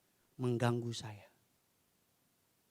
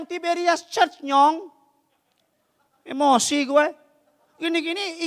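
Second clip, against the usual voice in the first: high, loud, insistent pulpit delivery; preaching at full force; 13 dB louder than usual. Acted. Preacher telling the congregation of a Holy Land trip, forceful preaching. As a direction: preach it loudly, pitched up high.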